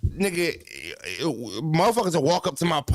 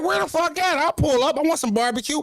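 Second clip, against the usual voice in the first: eager demand. Retold or imitated. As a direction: jump at the chance of free barbecue. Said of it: "I'm like".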